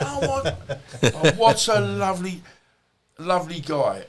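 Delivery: high, giggly, lost for words; laughing delight. Retold or imitated. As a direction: gush through a laugh, words failing. Cop overwhelmed at meeting him.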